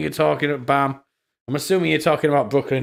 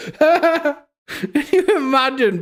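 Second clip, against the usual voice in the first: laughing; 11 semitones higher, much slower than usual. Real, not acted. Laughing after tweet.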